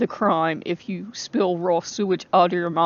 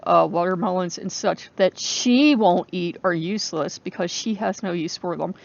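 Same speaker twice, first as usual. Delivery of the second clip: normal talking voice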